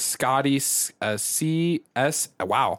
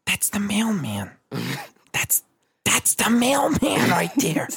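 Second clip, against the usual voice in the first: put-on slow, high kid's voice; mock child voice; kids voice; 6 semitones higher, slower than usual. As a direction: imitate the little girls pointing out the mailman. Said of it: "And they go".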